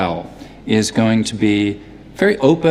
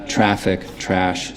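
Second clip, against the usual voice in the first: unraised Philadelphia vowel, listed word by word; Philadelphia accent; 4 semitones lower, 4 dB quieter, slower than usual. Shown as a demonstration. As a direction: open Philadelphia "ah" in "traffic" and "trash". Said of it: "something more open like my own".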